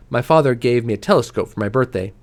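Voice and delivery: ordinary delivery, nothing marked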